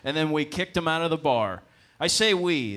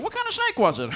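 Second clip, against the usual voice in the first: high, loud mimicked question; commentator's wife; 6 dB louder than usual. Commentator quoting his wife on the phone, high-pitched questioning voice. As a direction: imitate her in a raised pitch.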